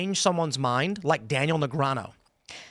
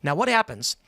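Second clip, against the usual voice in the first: normal talking voice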